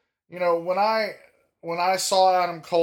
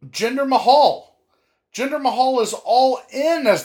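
hyped announcement; 9 semitones higher, 6 dB louder than usual. Real, not acted. Hyped naming of wrestler.

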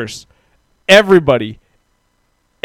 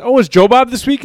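high-pitched, hopeful retold question; bright-eyed anticipation, mimicking oneself; eager question; 8 semitones higher than usual. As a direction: pitch it up like an eager fan asking. Same eager question retold, gets nope.

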